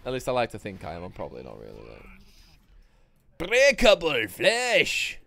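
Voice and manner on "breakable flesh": higher, louder, slow battle cry; drawn-out hammed-up war cry; mock battle cry. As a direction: roar it out slowly like a boss. Game-style battle cry.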